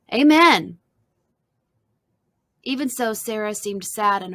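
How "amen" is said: full loud, higher-pitched shout; congregation shout. A narrator's reading. Congregation shouting as the Temples get their box, loud shout. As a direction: cry it out loud as one congregation. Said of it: "The congregation shouted".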